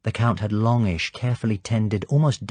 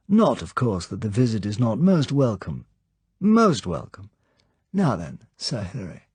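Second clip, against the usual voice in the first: the Count's voice; 3 semitones higher than usual. Acted. Count welcomes Sir Hilary, light animated high voice. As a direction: greet the guest lightly, full of animation.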